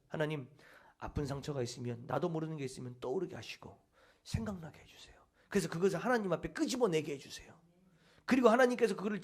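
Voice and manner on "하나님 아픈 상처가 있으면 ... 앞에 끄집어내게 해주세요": softer prayer sinking into a whisper; hushed prayer. Shown as a demonstration. Preacher models a private prayer for inner healing, hushed to a whisper. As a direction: murmur it to God, then whisper. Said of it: "이렇게 기도하세요".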